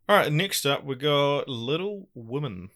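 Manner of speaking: slow, drawn-out Kiwi accent on Little Women. Real, not acted. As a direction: say Little Women the New Zealand way, slowly. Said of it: "Saying that in my Kiwi accent".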